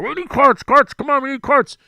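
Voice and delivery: high, loud shout of carts; loudspeaker yell. Retold or imitated. Starter calling again over the loudspeaker, loud. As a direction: call it out over the PA. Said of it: "He's on the loudspeaker".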